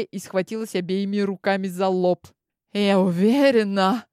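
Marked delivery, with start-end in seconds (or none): none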